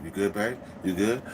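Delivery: low, soft aside; imitated secretive undertone; covert check-in. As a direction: drop the voice to check on a partner. Covert check between loud protests.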